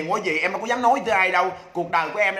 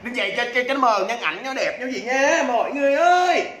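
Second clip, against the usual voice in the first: high, stretched call to viewers; showy call-out; 4 semitones higher than usual. Real, not acted. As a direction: build higher into a long "mọi người ơi". Host calling out to viewers.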